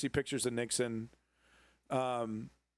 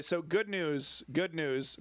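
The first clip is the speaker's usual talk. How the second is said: ordinary talk, nothing marked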